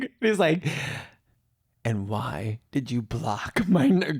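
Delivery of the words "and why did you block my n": slow, deliberate mock-menacing question; imitating stalker caller. Stalker on the phone after being blocked, slow mock menace. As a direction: draw out each word like a creepy caller. Stalker's line acted out again.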